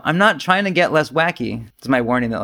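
ordinary delivery

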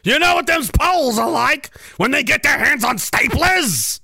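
high, hammed-up outburst; mock alarmed shout. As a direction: holler it as a comic warning. Mock outburst about Poles and staplers.

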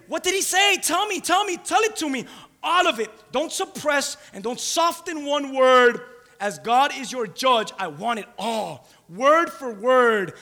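high, loud, forceful demand; insistent demand. Acted. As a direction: press hard for every last word, slowing as you go. Acts Eli demanding to hear everything.

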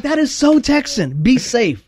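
high and slow with amused wonder; a visitor marvels at Texan manners; amused Boston friend. Retold or imitated. Slow, high, amazed visitor. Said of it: "He was like".

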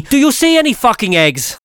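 a Polish lady snapping at a customer; mock shop lady. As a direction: sneer it back like the rude server. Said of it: "she said to me".

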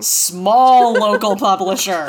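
SMALL stretched long and loud; slow, loud jab on SMALL; mock emphasis. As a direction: draw out SMALL slowly, with comic force.

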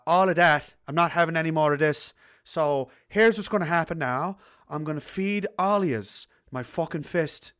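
half-Irish Newfoundland dad, slow and deliberate; bad Newfoundland accent. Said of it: "Minus the bad half Irish Newfoundland accent".

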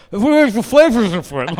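talking through a mouthful of turkey; mouth full. Retold or imitated. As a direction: chew the words, mouth full. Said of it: "eating with a mouthful".

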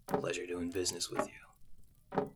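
soft, low-key boss voice; quiet NPC voice. Acted. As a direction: say it quietly, almost a murmur. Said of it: "And he says".

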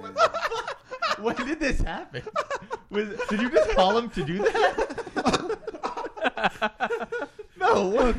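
high squeaky laughing disbelief. Real, not acted. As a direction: crack up in shock. Shocked by coworker's bit.

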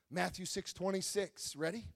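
normal talking voice

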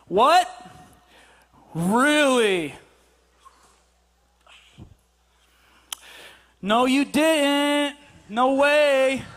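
absent-minded, drawn-out mock reactions; feigned interest. Husband not listening to wife, hollow drawn-out interest. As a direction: stretch out hollow replies with long gaps. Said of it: "We're not listening at all".

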